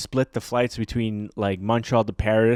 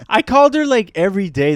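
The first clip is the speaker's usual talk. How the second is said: high and loud burst of protest; indignant jump in pitch; emphatic protest; 9 semitones higher, 8 dB louder than usual. Real, not acted. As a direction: fire it back in disbelief. Emphatic complaint about constant calls.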